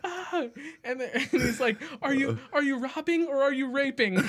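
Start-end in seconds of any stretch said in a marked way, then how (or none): 0.0-0.4 mock panicked scream. Guy who got caught, screaming in mock panic. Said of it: "he's screaming". 2.0-4.2 laughing quote. Retold or imitated. Retelling movie scene with laughing.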